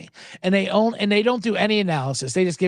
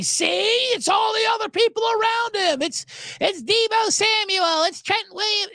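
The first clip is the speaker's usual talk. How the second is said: much higher, gloating mock critic voice; 14 semitones higher than usual. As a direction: sneer out the doubters' told-you-so. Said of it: "people could not wait to say".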